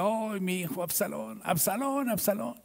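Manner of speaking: high, hushed lament for a lost son; mournful wail. Retold or imitated. Grieving king David softly wailing for his dead son. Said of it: "Y lloraba y decía".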